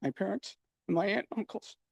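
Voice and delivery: barely holding it together; tearful. Real, not acted. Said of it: "I apologize. I don't know why I'm getting emotional".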